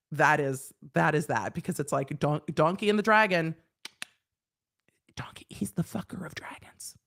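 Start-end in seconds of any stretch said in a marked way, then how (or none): 5.1-6.9 soft naughty whisper; whispered cheeky punchline. Acted. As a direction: hush it like a dirty secret.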